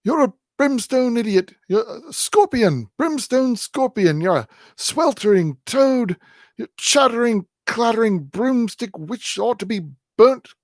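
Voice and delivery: put-on high, loud, breathless old-man insults; loud high old-man rage; gasping furious old man. A narrator's reading. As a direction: spit out curses at his wife, winded. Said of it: "gasps the old man".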